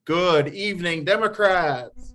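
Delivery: loud, high call with drawn-out "Democrats"; high, stretched-out crowd greeting; bigger rallying greeting. Real, not acted. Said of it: "Let's try it one more time".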